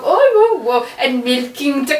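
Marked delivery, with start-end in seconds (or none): 0.0-0.9 high, loudish playful whoas; playing common people. Queen playing at farm life, mock common-folk whoas. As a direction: exclaim like a pretend peasant at play. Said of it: "acting like you're one of the common people".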